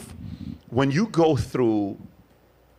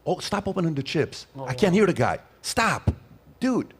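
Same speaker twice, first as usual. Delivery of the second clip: high-pitched, urgent shushing; irritated audience hushing; 7 semitones higher than usual. Acted. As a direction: act out listeners straining to hear, annoyed. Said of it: "It was like this".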